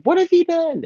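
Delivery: very high, hammed-up anguish; mock falsetto wail. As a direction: overact the cry. Repeated line, higher.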